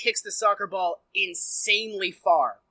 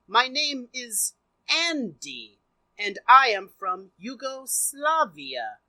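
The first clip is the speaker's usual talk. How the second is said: put-on a light, slow Yugoslavian accent, each word deliberate; barely-there Yugoslavian accent, spoken slow and careful; 2 semitones higher, slower than usual. Mocking the hero's Yugoslavian accent, slowly and deliberately. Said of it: "He has a Yugoslavian accent".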